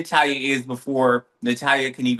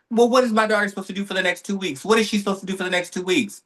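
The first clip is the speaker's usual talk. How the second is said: fast, higher-pitched retold demand; demanding mother; 5 semitones higher, much faster than usual. Mother pressing the doctor about her daughter, fast and demanding. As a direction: rattle it off fast, pressing for an answer.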